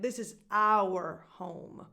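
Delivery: "our" loud, long and full, like "hour"; stress on OUR. Shown as a demonstration. Coach showing the emphasised word, stress on our. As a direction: punch "our" slowly as "ow-er". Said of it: "if you're stressing it".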